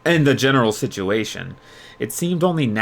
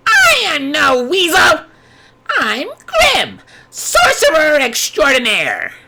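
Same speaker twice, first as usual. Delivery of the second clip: high, loud, indignant creature voice; Grim proclaiming; 7 dB louder, slower than usual. A narrator's reading. Grim proclaims his name to Yuya, high and loud. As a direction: puff up and declare yourself grandly. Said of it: "the stuffed animal yelled".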